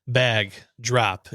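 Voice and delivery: ordinary delivery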